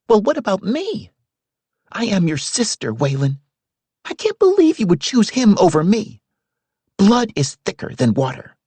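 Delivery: high, pleading and hurt; upset young woman. A narrator's reading. Sister pleads with her brother about Milton, high and hurt.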